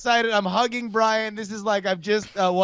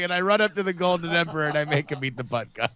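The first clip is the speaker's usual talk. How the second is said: ordinary delivery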